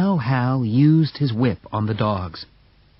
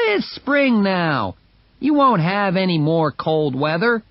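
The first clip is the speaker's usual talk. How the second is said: onlooker man; 7 semitones higher than usual. Acted. Onlooker voiced in story.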